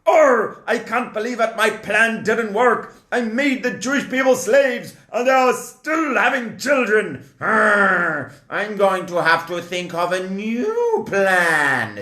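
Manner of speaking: high mock pirate with fake sobs; whiny pirate Pharaoh, fake crying. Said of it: "I'm doing a pirate voice for Paro now".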